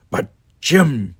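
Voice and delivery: high, loud, raspy objection; rasped protest. A narrator's reading. Kirk playing doctor to himself, rasping. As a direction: rasp out an exasperated plea. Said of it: "he rasped".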